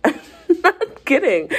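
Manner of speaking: high, slow protest through laughter; laughing. Real, not acted. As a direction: insist on it while cracking up. Laughs while quoting own protest.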